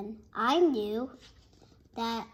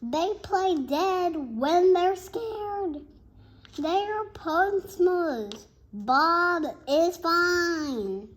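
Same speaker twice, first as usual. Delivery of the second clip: higher, louder and slow, proud of the pet; enthusiastic teacher; 5 semitones higher, 6 dB louder, slower than usual. Acted. Mister Cooper reassuring the kids, slow, high and proud. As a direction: beam about the class pet slowly.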